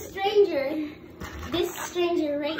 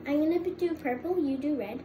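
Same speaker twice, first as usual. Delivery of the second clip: ordinary talk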